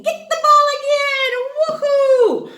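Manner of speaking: high, loud whoop; excited cheer. Real, not acted. Teacher cheering the kids who got the ball, loud. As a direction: throw in a big woohoo. Teacher celebrating a correct answer.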